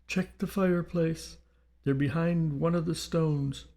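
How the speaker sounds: high-pitched, lightly British spirit; slight British accent. A narrator's reading. Dead Victor revealing where the bonds are, slight British accent. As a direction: answer from beyond with a touch of British. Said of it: "The accented voice returned".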